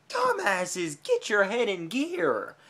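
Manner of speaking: ordinary talk, nothing marked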